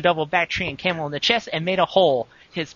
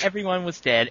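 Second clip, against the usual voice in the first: ordinary talk, nothing marked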